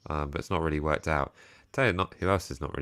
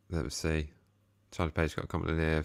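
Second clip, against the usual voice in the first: ordinary talk, nothing marked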